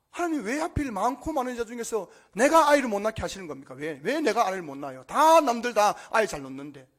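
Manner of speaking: loud, aggrieved plea to God; crying out in protest. Acted. Preacher voicing Hannah pleading with God, loud anguished protest. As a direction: demand an answer, pushing the voice up and out. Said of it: "그렇게 소리 지를 만하지 않습니까?".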